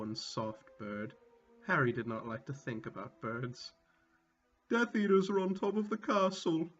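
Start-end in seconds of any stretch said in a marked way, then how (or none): 4.7-6.7 a touch of a scared quiver, bleated a bit higher; bleating, quivering. A narrator's reading. Ron warns his friends, in a mock quivering bleat. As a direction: Ron bleats fearfully about Death Eaters on the roof. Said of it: "Ron bleated, quivering".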